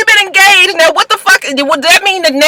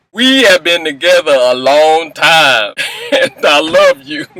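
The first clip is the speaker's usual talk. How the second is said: lower, earnest mock-romantic voice; Lorenzo's love speech; 7 semitones lower than usual. Boyfriend declaring love to British in Paris, mock-earnest and low. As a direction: ham up a sappy proposal. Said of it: "he gotta start with his speech".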